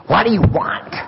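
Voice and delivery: loud, high-pitched irritated yell; retold angry yell. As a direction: yell it at an unwelcome knock at the door. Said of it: "I'm yelling".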